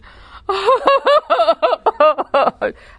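loud, high wailing sob; mock sobbing. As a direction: imitate a waitress bursting into loud sobs. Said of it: "and she sobbed".

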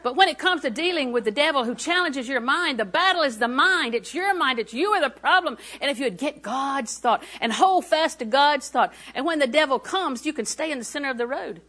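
emphatic exhortation, phrase after phrase; fervent preaching. Real, not acted. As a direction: press the point urgently on the congregation. Preaches with rising force.

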